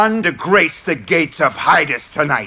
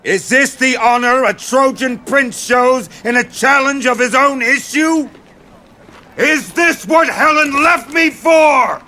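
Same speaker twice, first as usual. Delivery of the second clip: rising to a higher, furious shout; outraged shout; 6 semitones higher than usual. Acted. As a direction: hurl the insult at the fleeing prince. Menelaus outraged at Paris fleeing.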